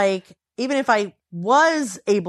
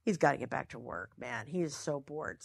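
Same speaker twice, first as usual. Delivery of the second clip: much softer, under her breath; muttered aside; 7 semitones lower, 16 dB quieter than usual. Retold or imitated. Wife muttering to herself about her bored husband, under her breath. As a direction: mutter it so he can't hear.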